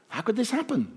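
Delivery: high, stunned exclamation at the empty grave; shocked cry. A narrator's reading. Women finding the empty tomb, stunned and high. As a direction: cry out in disbelief. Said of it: "the women all cried".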